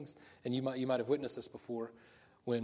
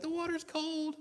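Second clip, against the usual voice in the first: put-on little girl voice; slower than usual. Daughter quoted in dock story.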